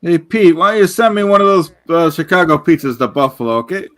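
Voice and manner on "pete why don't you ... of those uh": normal talking voice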